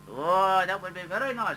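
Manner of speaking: high, sing-song Indian accent, hammed up; mock Indian accent. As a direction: play the Indian girl, sweetly and politely, in an Indian accent. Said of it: "beautiful Indian girl".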